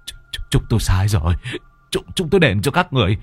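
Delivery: fast, stuttering, terrified begging; a strangled man pleading for his life; stammering plea. Acted. Husband begs the ghosts choking him, frantic. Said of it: "thì họ mới van xin".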